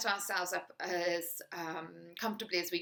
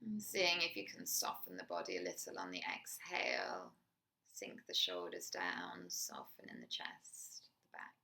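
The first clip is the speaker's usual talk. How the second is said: guided meditation; 4 dB quieter than usual. Acted. Teacher guiding a listener through a body scan, soft meditation voice. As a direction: ease the listener into the body, gently.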